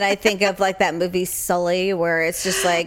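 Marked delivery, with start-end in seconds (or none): none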